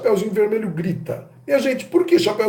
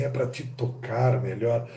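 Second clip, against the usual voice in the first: softer, slow suggestive line; sensual reply; 5 semitones lower, 9 dB quieter, slower than usual. Acted. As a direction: let the wolf answer with a lure. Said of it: "o jogo e a sensualidade".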